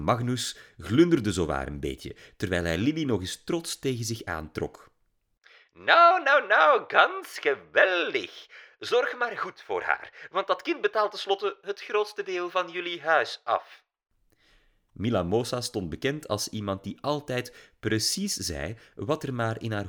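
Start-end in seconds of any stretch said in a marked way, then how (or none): 5.7-13.8 put-on very high, loud, slow German-accented gushing; old German diva teasing the father. A narrator's reading. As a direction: gush "ganz geweldig" like a German diva. Diva's blunt remark.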